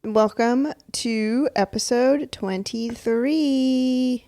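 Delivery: very slow, stretched welcome; drawn-out announcement. Acted. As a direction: stretch every word of the intro. Drawn-out episode intro.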